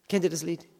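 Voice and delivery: ordinary talk, nothing marked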